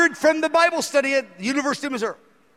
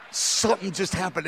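low, soft, overwhelmed; retold hushed disbelief; quietly shaken by what just happened; stunned awe; 7 semitones lower, 6 dB quieter than usual. Retelling his overwhelmed reaction to the verse.

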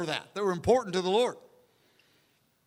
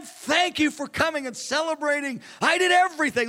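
excited boasting; 10 semitones higher than usual. Retold or imitated. Saul gushing to prophet Samuel about his victory, excited shout. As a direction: crow about the win to Samuel, well above normal pitch.

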